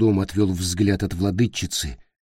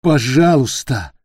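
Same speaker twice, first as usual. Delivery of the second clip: slow, raised, begging please; pleading; 5 semitones higher, 7 dB louder than usual. Acted. Peter pleading with the queen for help. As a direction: beg with everything. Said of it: "умоляюще заговорил он".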